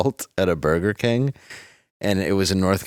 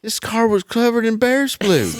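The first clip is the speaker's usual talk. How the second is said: gossiping wife; 13 semitones higher than usual. Retold or imitated. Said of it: "telling her husband".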